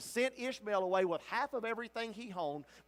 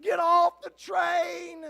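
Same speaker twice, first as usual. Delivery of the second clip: desperate high shout, slow and drawn out; screaming warning; 8 semitones higher, 8 dB louder, much slower than usual. Retold or imitated. Pastor screaming at people heading for a wreck, desperate shout. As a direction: yell for them to jump before the crash. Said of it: "jumping up and down screaming".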